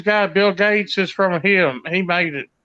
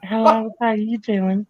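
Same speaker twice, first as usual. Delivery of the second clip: put-on girl voice. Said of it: "I can talk like a girl".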